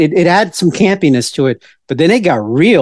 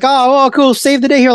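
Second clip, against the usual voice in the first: higher, cheering as the crowd; mock cheering audience; 10 semitones higher than usual. Theater audience cheering on the hero, mock excited. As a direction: gush like a thrilled theater crowd. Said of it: "audience vibe and feel like".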